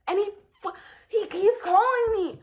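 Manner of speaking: exasperated. Real, not acted. Telling viewers about dad's calls, loud and exasperated. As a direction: drag it out, fed up.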